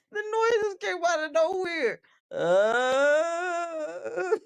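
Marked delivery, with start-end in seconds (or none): 2.3-4.4 short pained grunt imitated; mock wounded groan; wounded mammal groan. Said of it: "She sound like a wounded mammal".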